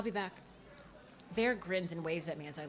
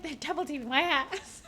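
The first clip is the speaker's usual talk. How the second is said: laughing quote; 7 semitones higher than usual. Retold or imitated. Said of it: "He said".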